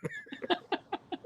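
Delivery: a burst of high laughter, breathy; high squealing laugh. Real, not acted. Host laughing at his own story, high-pitched. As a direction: laugh at your own dream mix-up.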